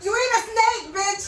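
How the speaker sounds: loud laughing jab; teasing through laughter; laughing tease. Real, not acted. Teasing a failed trill.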